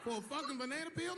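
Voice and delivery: high, disbelieving squeal of outrage; can't believe the question; incredulous. Real, not acted.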